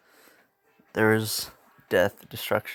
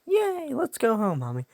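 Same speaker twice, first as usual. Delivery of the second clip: put-on much higher, chirpy child voice; cheery little kid; 11 semitones higher than usual. Izuku tells his mommy to go home, high cheery child voice. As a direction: squeal it like a happy toddler. Little body says to mother.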